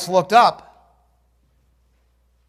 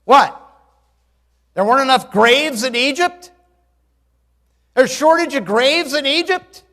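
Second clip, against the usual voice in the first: higher, louder mocking grumble; sarcastic complaint; 6 semitones higher, 5 dB louder than usual. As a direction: sneer it like a bitter crowd. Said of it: "this is what they said to Moses".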